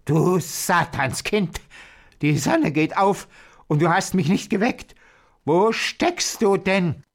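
high, furious witch's rant; screaming witch. A narrator's reading. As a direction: shriek angrily at the missing girl. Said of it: "und schrie".

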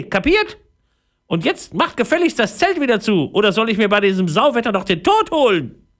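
tiny high voice, indignant and scolding; an outraged little fairy telling off a giant; furious fairy. A narrator's reading. Fairy called a Barbie doll scolds Leon furiously.

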